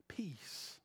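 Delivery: breathy unvoiced whisper of Peace; a hushed whisper of Peace. Shown as a demonstration. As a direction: whisper the word Peace. like a blessing. Echoing the word gently.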